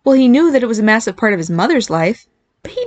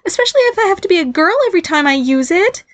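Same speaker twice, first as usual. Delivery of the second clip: put-on higher squeaky young voice; girlish voice; 11 semitones higher than usual. A narrator's reading. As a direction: protest in a squeaky girl voice. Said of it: "he insisted".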